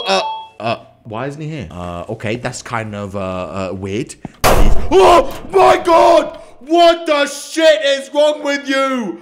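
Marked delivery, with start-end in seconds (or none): 4.4-9.2 loud, high, slowed yell of fright; startled shout. Real, not acted. Streamer shouting at the coworker who scared him, loud high yell. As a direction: scream it at whoever just scared you.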